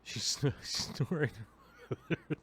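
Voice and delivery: giggling through the words; laughing. Real, not acted. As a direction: break up laughing mid-sentence. Tickled by the snoring dog.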